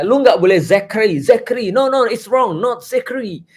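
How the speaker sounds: high, insistent pretend correction of Zekri to Zakaria; mock scolding correction. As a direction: play a pushy know-it-all correcting a name. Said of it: "memaksa orang untuk".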